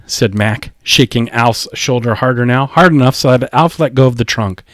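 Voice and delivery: plain reading voice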